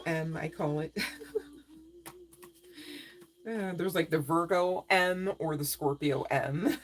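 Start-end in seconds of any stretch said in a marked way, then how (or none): 4.1-6.9 slow, chuckling through the letters; laughing. Real, not acted. Reader joking about zodiac initials, laughing. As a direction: giggle at your own pun. Laughing at her own joke.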